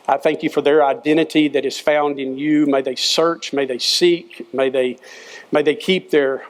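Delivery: coach's earnest prayer; closing prayer. Acted. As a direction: pray over the kids sincerely.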